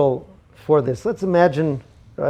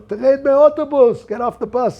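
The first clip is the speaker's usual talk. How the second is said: slow, loud and much higher mock yell; crowd yelling; 10 semitones higher, 6 dB louder than usual. Passengers yell at the fare dodger to get off. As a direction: bellow it like a whole bus of angry riders. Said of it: "everybody else on the bus starts to yell".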